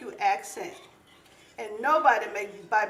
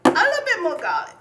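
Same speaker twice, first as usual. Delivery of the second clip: high, loud, quick laughing line; 8 semitones higher, 9 dB louder, faster than usual. Real, not acted. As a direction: toss it off with a burst of laughter. Laughing while adding garlic.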